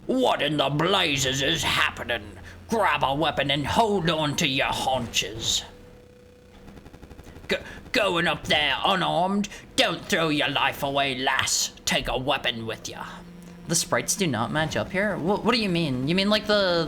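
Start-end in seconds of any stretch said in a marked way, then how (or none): 0.0-5.6 put-on salty old seadog voice; rally the crew like a sailor; hammed-up boatswain; gruff boatswain character. 7.5-13.0 put-on salty old seadog voice; rally the crew like a sailor; hammed-up boatswain; gruff boatswain character. Continues voicing the Boatswain.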